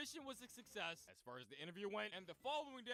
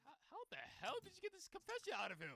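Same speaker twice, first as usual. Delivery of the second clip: much higher, disbelieving squeak; high incredulous mock L; stunned L; 11 semitones higher than usual. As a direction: gasp it out in disbelief. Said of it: "Having L over here like".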